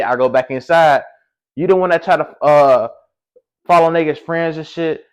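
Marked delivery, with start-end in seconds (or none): 1.5-5.0 high, forceful comeback; worked-up retold argument; retold heated accusation. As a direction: throw the blame back, voice raised. Retold quarrel on the phone.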